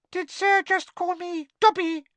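curious, very high-pitched elf squeak; squeaky house elf. A narrator's reading. House elf questions Harry from between fingers, curious squeak. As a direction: squeak the question like a tiny creature. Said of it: "squeaked the elf curiously".

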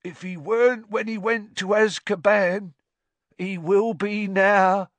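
a high, slow, heavy drawl. A narrator's reading. Ern answers Stan in his slow voice. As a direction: drag it out like an old driver. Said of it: "said Ern in his slow voice".

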